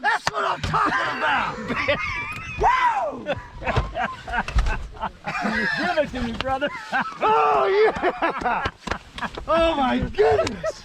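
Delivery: high whooping celebration; man down shout. Real, not acted. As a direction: whoop and laugh in triumph. Celebrating the kill.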